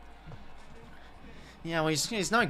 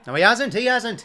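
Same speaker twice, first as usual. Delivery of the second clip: loud, higher burst of disbelief; excited reaction; 7 semitones higher, 10 dB louder than usual. Real, not acted. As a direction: call it out loud as the moment turns. Reacting live to the kick.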